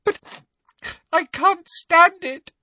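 breaking down, voice pitched right up; sobbing. A narrator's reading. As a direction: wail it out between sobs. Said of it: "Hermione was sobbing desperately".

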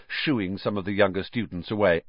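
ordinary delivery

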